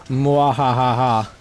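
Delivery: evil villain cackle; hammed-up mwahaha laugh; mad scientist. Villain laugh after threat.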